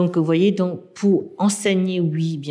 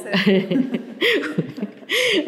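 laugh; 11 semitones higher than usual. Real, not acted. Laughs at her own slip.

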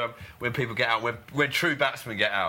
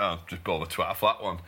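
Jimmy impression; 5 semitones lower than usual. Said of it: "I love your Jimmy impression".

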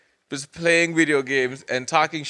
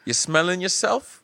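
imitated mom's challenge, pitched up; higher, questioning mock challenge; challenging mom; 6 semitones higher than usual. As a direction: retell a mother calling out her son. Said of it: "that's how my mom would challenge me".

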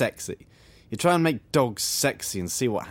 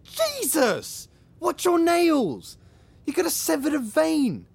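high-pitched, indignant scolding; pained indignation; 14 semitones higher, slower than usual. Acted. Resident scratched by someone's nails, pained and indignant. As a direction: scold right after getting hurt.

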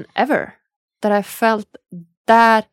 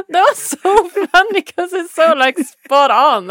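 laughing; 8 semitones higher, 5 dB louder, slower than usual. Real, not acted. Laughing at the nickname.

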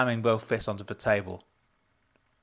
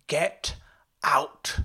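high, strained order to leave; restrained yell, word by word; 6 semitones higher than usual. A narrator's reading. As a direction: throw out each word separately. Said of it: "she yelled at Jenna".

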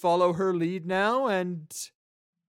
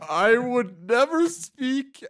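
flustered prisoner; 9 semitones higher, 12 dB louder, slower than usual. Acted. NPC being slapped, caves.

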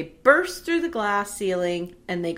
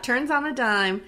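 ordinary talk

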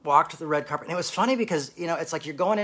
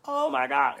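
delighted gasp; 13 semitones higher, slower than usual. Retold or imitated. Drag queen learns who he is.

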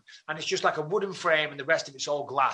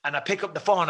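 normal talking voice

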